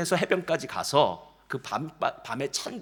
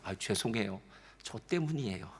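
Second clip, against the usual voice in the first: softer, meek admission; a mild sheepish sorry, softer; humble apology; 8 semitones lower, 10 dB quieter than usual. Retold or imitated. Humble person admitting fault, softly apologizing. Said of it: "자기 실력을 진짜 아는 사람은요".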